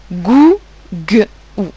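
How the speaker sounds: "gou" whole, then split into g and ou; sounding out. Shown as a demonstration. Teacher showing pupils where ou falls, sounding out. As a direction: separate the g from the ou in gou. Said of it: "On l'entend à la fin de la syllabe".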